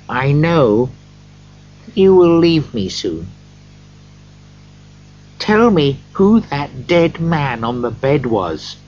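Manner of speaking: a hint of a worried miser; Scrooge. A narrator's reading. Scrooge pleads with the ghost.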